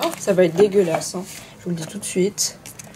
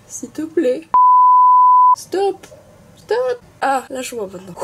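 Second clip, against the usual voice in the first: pleading; 7 semitones higher, 9 dB louder than usual. Acted. Pleading against slime.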